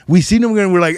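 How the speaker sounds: usual speaking voice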